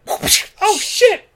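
high, airy squeak of alarm; mock panicked gasp. A cop startled by the vampire, high yelp. Cop reacting in retold scene.

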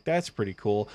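normal talking voice

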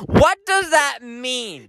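high, drawn-out disbelief; acted bewildered cry; baffled outburst. Baffled outburst at waitress's question.

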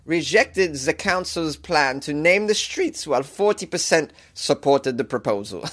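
news read in a hammed-up German accent; bad German accent. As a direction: amateurish German-accented newsreader. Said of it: "This is the worst German accent".

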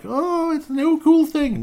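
very high, loud, slow airhead voice; squeaky hammed-up teen gush; mocking teenager. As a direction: parody an excited teen gushing. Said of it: "You think I'd be like".

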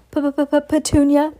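high stuttering panic; shocked stammer calling his wife. Acted. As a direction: stumble over her name in fright.

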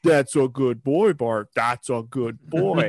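slow, lightly voiced soothing of an animal; calm the bear down, slowly; soothing trainer. Trainer calming the bear, slow and soothing. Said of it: "the cage just goes quiet and he goes".

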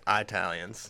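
"Italians" said as "eye-talians"; Parkersburg pronunciation. Retold or imitated. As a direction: start "Italians" with a long "eye". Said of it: "that's how they say it in Parkersburg".

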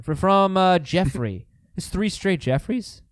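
normal talking voice

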